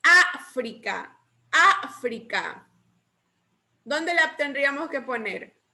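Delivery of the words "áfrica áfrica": África, slow, with a punched opening Á; África slowly, first syllable emphasized; stress on África's first syllable. Shown as a demonstration. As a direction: hit the Á, then let frica fall in África. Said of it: "Miren bien dónde lleva el acento".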